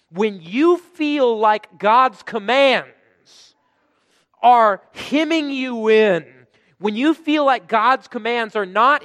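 loud, high, very slow preaching; loud, slow pulpit emphasis; preaching at full force. Real, not acted. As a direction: drive every word home to the congregation. Preacher driving the point home.

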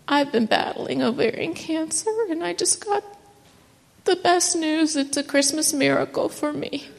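high, emotional, near-crying voice; holding back grateful tears; choked up and thankful; tearful joy. Real, not acted. Retelling crying in the pharmacy.

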